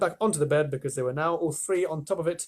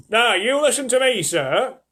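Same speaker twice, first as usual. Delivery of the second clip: put-on higher, louder old man's reprimand; scolding Grandpa George; 8 semitones higher, 8 dB louder, slower than usual. A narrator's reading. Old grandfather in bed scolding Wonka, louder and higher. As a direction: tell him off like a grumpy old man. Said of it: "said old Grandpa George".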